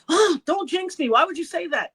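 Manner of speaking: high-pitched retold protest to a sister; alarmed protest. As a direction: imitate a startled, playful telling-off. Retold sister banter, protesting jinx.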